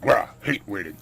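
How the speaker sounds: loud, grumbling companion voice; loud put-on grumble; grumpy companion. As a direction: burst out in complaint as the companion. Voices game companion Alphonse.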